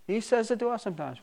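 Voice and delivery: ordinary talk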